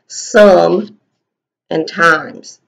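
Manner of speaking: Some and times said as two separate words; split word parts. Shown as a demonstration. Teacher showing the class the parts of sometimes. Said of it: "sometimes is two words put together".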